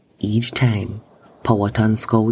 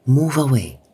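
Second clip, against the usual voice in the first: higher-pitched narrated command; crying out; 5 semitones higher than usual. A narrator's reading. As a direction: cry out a warning, without full volume. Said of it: "he cried out".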